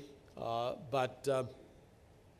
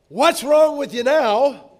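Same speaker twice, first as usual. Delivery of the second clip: much higher, louder, slow exasperated jab; harsh words; 11 semitones higher, 15 dB louder, slower than usual. Retold or imitated. Preacher quoting a husband's harsh words to his wife. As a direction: hurl it as a sharp accusation. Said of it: "But harsh words like".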